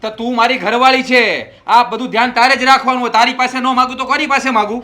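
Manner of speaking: loud, high, furious yelling at his wife; angry shout. Acted. Husband shouting at wife over lost watch, angry shout. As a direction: bark it at her like a fed-up husband.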